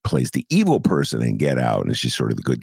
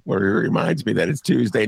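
ordinary talk

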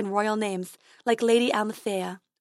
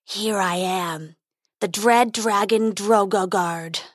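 growling dragon; slower than usual. Acted. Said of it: "growled the dragon".

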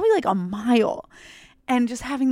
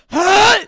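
a loud, high retching heave; mock retch; 10 semitones higher, 10 dB louder than usual. On all fours in the wheat field, loud mock retch. Retelling her ayahuasca-style purge.

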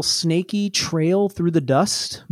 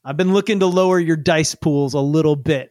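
ordinary talk, nothing marked

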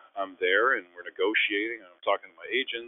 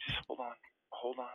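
soft, higher aside; hushing; 5 semitones higher, 12 dB quieter than usual. Retold or imitated. On the phone, holding off his brother, hushed. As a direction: shush someone quietly mid-call.

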